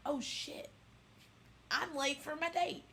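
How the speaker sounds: sudden high startled gasp into a rush of words; panicked realization. Retold or imitated. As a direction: snap from a breathy curse into high alarm.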